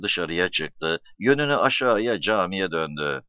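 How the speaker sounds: plain reading voice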